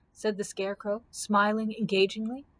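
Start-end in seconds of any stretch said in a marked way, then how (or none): none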